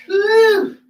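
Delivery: high, happy woo; excited cheer. Real, not acted. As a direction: celebrate finishing the set. Cheering at workout end.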